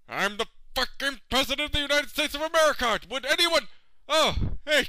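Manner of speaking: high, loud, rushed outburst; desperate yell. Acted. Stuck president demanding help, desperate shouting. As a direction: yell for anyone at all to come.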